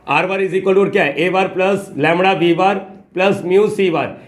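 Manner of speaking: very slow, stretched dictating pace; slow dictation. Shown as a demonstration. Teacher dictating the formula slowly to students. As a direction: draw each word out while writing.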